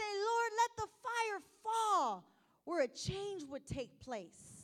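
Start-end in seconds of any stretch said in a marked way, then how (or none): 0.0-2.2 fervent prayer. Retold or imitated. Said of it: "how many times do we say".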